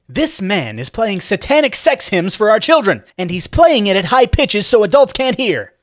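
loud, outraged accusation; furious complaining parent; indignant mother. A narrator's reading. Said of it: "the woman stated".

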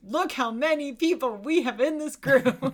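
loud, high showing-off voice; proud boast. Pretend. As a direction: brag about the big crew. Mocking the filmmakers' boast.

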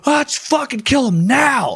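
high, shouted mob cry; hammed-up lynch-mob shout. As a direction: yell it like a bloodthirsty crowd. Mob demanding a hanging.

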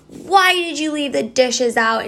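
half-hearted pretend yell; mock scream. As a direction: play-act snapping at the husband over the dishes. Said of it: "and scream".

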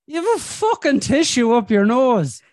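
loud, high incredulous outburst; amused disbelief. Real, not acted. Man spots a tissue up his listener's nose, amused disbelief.

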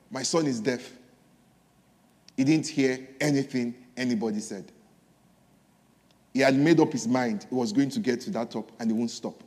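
low, soft, solemn quote; grave, quiet disclosure; hushed revelation. Retold or imitated. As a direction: let the mother's words drop low and quiet, with long pauses. Frog mother's profound reveal.

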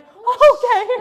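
high, loud, drawn-out mock okay; incredulous okay. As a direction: squeal okay in exaggerated disbelief. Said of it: "I was like".

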